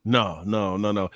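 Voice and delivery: normal talking voice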